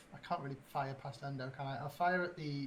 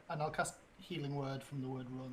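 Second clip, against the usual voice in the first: ordinary talk, nothing marked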